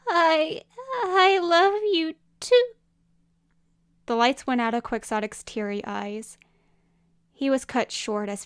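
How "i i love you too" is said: high, halting, slow sob; tearful hiccuping. Acted. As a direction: confess love through tears, haltingly. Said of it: "Quixotic hiccuped".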